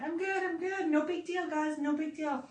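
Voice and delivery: high, soft brave front; faking fine. Retold or imitated. [name] telling everyone she's fine, soft high fake brave. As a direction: brush it off in a small high voice. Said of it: "And I'm like".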